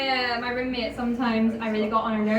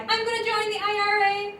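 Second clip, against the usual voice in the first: a hint of a higher drunk sing-song; lightly voiced drunk granddad; 9 semitones higher than usual. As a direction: toss off the old threat half-heartedly. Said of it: "my granddad was like".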